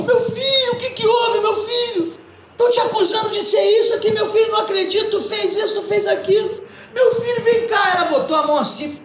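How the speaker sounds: distraught mother pleading. Acted. Mother at the cell bars.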